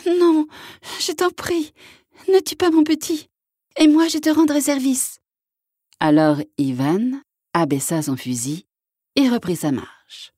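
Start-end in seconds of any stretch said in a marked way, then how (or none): 0.1-5.2 very high, pleading cry; crying mother eagle. A narrator's reading. Mother eagle begs hunter to spare her young, high cry. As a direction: cry out for your baby's life. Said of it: "la maman aigle cria".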